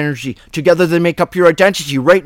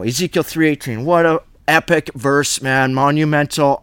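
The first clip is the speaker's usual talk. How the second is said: usual speaking voice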